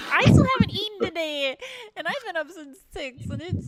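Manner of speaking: moaning complaint. Real, not acted. Hungry player justifies wanting food, moaning loudly. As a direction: moan about being hungry, half laughing. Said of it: "I'm gonna moan about it".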